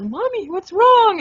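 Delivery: put-on high, slow little child's voice; a small kid asking her mom, concerned; worried child. Daughter voiced in story.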